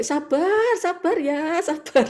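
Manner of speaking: high laughing calm-down; soothing through laughter. Retold or imitated. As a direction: coax patience while laughing. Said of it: "kita sampai". (menenangkan sambil tertawa)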